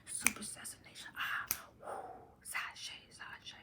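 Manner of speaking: playful whisper trailing away. Pretend. Chanting her rhyme to viewers as a fading whisper. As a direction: breathe the words out, fading to nothing.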